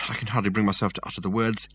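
usual speaking voice

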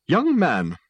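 high, loud rebuke; stern old lady. A narrator's reading. Old lady with dog rebuking swimmer, stern. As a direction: call out like a reproving old lady. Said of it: "said the old lady".

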